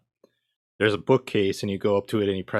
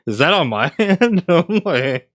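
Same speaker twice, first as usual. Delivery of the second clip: laughing; 8 semitones higher, 9 dB louder than usual. Real, not acted. Laughing through a surprise mishap.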